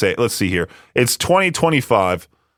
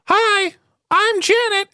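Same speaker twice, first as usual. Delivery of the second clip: much higher, put-on girly voice; slower than usual. Answering a scam caller as Janet, in a much higher put-on girly voice. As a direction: squeak it out like a fake young woman. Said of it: "I'll put on my best girly voice".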